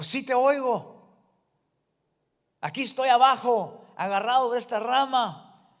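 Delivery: responde a gritos, agudo y fuerte; gritando hacia arriba. Retold or imitated. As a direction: grita para que la voz lo oiga. Jack answers from below. (Jack colgado le grita a la voz de arriba)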